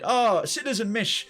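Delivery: usual speaking voice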